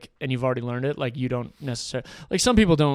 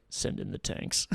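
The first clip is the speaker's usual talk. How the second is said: deep whispered Russian leader order; hushed, low command to send tanks; Yeltsin quote; 7 semitones lower, 10 dB quieter, slower than usual. Retold or imitated. Yeltsin coolly ordering the tanks, low and hushed. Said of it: "Boris Yeltsin ... was like".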